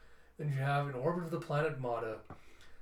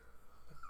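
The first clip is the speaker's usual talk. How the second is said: soft, voiceless chuckle; real laughter breaking in; quiet laugh; 13 dB quieter than usual. Real, not acted. Storyteller amused by the scene, quiet laughing.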